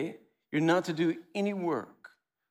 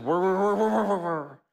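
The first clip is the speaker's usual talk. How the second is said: rapid-fire, raised, louder run of repeats; nonstop work; 2 semitones higher, 4 dB louder than usual. Acted. Preacher mimicking a life of only work, nonstop and insistent. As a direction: rattle it off like a relentless machine.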